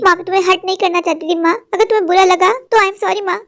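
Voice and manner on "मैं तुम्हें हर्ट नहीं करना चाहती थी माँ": ordinary delivery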